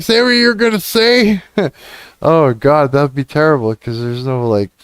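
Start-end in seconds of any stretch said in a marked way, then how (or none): 0.0-1.4 mock courtroom command. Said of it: "can I just be like".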